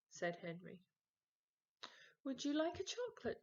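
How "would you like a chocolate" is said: higher, louder and fast, jittery; nervous offer. Acted. Peter offering chocolates to Mum, fast and jittery. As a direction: rush it out with nerves.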